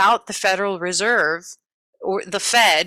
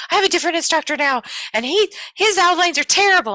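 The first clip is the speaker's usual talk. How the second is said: much higher, fast, louder student complaint; student telling her teacher about a new instructor, higher and fast; imitating student; 10 semitones higher, 3 dB louder, faster than usual. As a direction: mimic a frustrated student. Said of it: "She's like".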